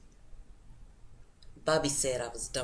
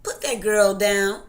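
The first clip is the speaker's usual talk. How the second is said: higher, much louder motherly command; mother calling out; 8 semitones higher, 10 dB louder than usual. Acted. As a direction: call out sharply to dad to put the child down. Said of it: "Mama would have to say".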